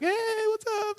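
high, bright mock hello; giddy greeting. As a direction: squeal it like a proud newly engaged guy. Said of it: "I was like".

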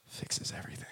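near-whisper for the punchline; hushed emphasis. Real, not acted. Preacher landing his point to congregation, hushed whisper. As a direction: drop to a whisper on the key point.

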